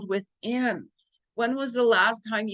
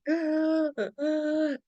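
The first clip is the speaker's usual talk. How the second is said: high, drawn-out wheezing gasp, mimicking a friend; mock pained gasps of laughter; imitated helpless-laughter gasp; 8 semitones higher than usual. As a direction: mimic someone laughing so hard it sounds like pain. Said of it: "either crying or she was, like, in pain".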